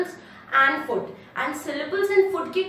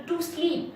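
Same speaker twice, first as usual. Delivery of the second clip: "to sleep" soft, sleep slightly raised; stress on sleep; 4 semitones higher, 4 dB quieter than usual. Shown as a demonstration. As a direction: keep "to" light, lift sleep. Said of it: "sleep थोड़ा सा ऊपर होता है".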